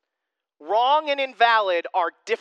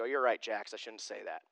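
much softer, meek admission; mock guilty dad, much softer and lower; sheepish apology; 10 semitones lower, 11 dB quieter than usual. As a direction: concede to the child, deflated. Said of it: "I have to go".

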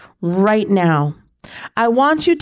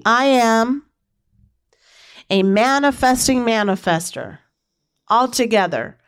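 affirmation chant building higher with each repeat; call-and-response affirmation; 4 semitones higher than usual. Pretend. Leading listeners in a rising affirmation chant. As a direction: lead the crowd, lifting higher every time. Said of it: "Say it with me".

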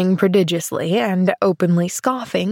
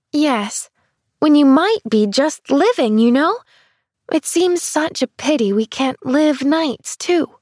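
put-on higher, bright-eyed young girl; little girl voice; 6 semitones higher than usual. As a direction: play an eager little girl. Child's voice, eager.

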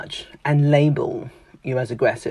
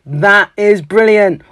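loud, slow, higher "That is brilliant"; enthusiastic; 7 semitones higher, 10 dB louder, slower than usual. Retold or imitated. Enthusiastic speaker praising someone's work, excited. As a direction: gush with real delight. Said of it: "I am that enthusiastic person".

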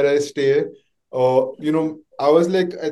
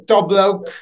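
higher, clumsy fake Australian accent; mimicked Australian accent; 5 semitones higher than usual. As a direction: imitate a mate's Australian accent, badly. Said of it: "The way he said".

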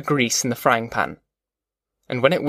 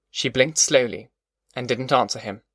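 usual speaking voice

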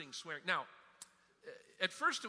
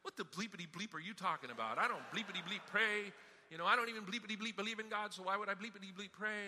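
mock indignant retort; 4 semitones higher, 5 dB louder than usual. Retold swearing golfer's indignant retort.

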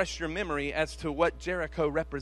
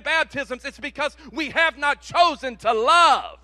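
loud, high, fired-up sermon; full-force preaching; 9 semitones higher, 13 dB louder than usual. Real, not acted. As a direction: drive it home to the congregation.

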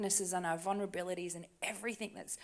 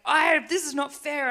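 loud, high protest near tears; furious outburst; 8 semitones higher, 13 dB louder than usual. Retold or imitated. To someone in their office, a furious loud protest. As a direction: shout it out like it's unjust. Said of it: "I got all angry and upset and furious".

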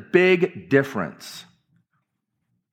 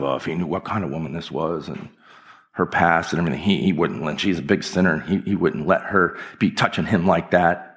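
Pharisee's inner thoughts; 8 semitones lower than usual. Retold or imitated. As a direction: mutter it low like a judging Pharisee. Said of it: "the Pharisee's thinking to himself".

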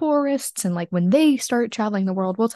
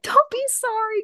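very high, drawn-out mock laughing cry; retold laughing reassurance; slower than usual. Telling fiance not to apologise for the rain, high laughing reassurance. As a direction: squeal it slowly, as if through laughter. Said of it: "I just start laughing".

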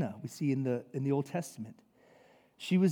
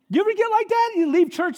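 ordinary talk, nothing marked

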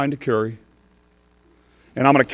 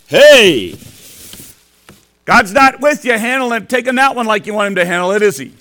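Satan taunting; 7 semitones higher, 8 dB louder than usual. Acted. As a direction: sneer it like the devil at the trash can. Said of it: "And Satan goes".

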